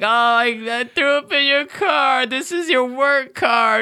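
hammed-up sobbing whine; mock crying. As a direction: imitate a drunk fiancée bawling an apology.

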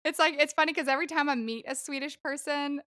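ordinary talk

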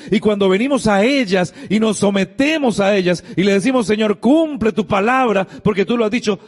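high, lifted preaching cadence; fervent preaching. Acted. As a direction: proclaim the promises like a pastor. Preaching promises of scripture.

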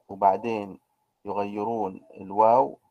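ordinary delivery

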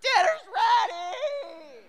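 high, drawn-out dinner holler; loud re-enacted back-door yell. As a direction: call across the farmyard in long, sung-out syllables. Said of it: "go out the back door and yell".

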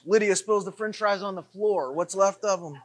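ordinary delivery

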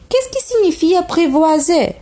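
demande-le avec une voix d'enfant plus aiguë; petit prince curieux. Acted. Le prince pose sa question. (le petit prince interroge le renard, voix d'enfant aiguë)

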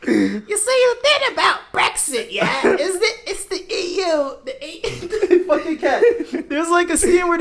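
very high, loud ditzy voice with laughs; high airhead parody through giggles; mocking Love Island contestant. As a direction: play a clueless islander explaining Brexit. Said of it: "talking about what Brexit is".

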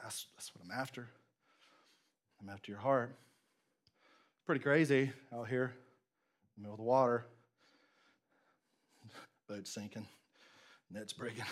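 low, very soft murmur; tender, intimate appeal. Acted. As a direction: speak gently to one person's heart. Voicing Jesus tenderly to hearts.

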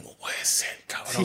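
hushed, voiceless aside; dismayed undertone. Retold or imitated. Driver seeing six suitcases, hushed dismay. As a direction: whisper it to yourself in disbelief.

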